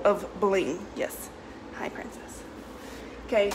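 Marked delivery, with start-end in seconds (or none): 0.9-2.4 soft whispered pet greeting. Real, not acted. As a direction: whisper to the puppy. Greeting the dog.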